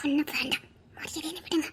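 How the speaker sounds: urgent whispered warning. Acted. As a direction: hiss it under your breath. Secret whispers away from family.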